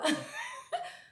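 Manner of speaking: a sudden high burst of laughter; startled laugh. Real, not acted. Caught off guard by the consul's question, high startled laugh. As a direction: laugh out in disbelief.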